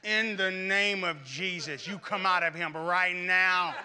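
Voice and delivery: slow, deliberate rebuke in Jesus' name; a praying mother drives the devil out, word by word; mother commanding the devil to leave, slow and stern; mother casting out. Retold or imitated. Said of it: "And she said".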